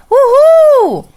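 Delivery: high, loud joyful whoop; celebration whoop. Shown as a demonstration. Teacher demos a head voice whoop, high and loud. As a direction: whoop like you just won. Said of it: "você faz isso aqui".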